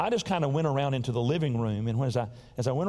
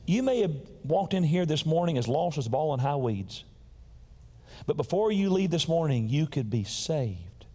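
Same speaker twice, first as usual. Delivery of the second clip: ordinary talk, nothing marked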